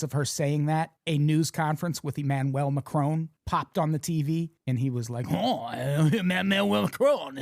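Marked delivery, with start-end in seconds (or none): 5.3-7.4 mock French accent. Retelling Macron on TV, a light mock French accent. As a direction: lean into the French accent as a joke. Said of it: "very stereotypical French accent".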